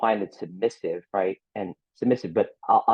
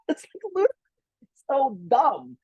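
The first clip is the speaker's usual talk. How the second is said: high, laughing disbelief; incredulous squeak. Retold or imitated. Still mocking, high and incredulous about the double standard. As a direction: squeal at how dumb it is.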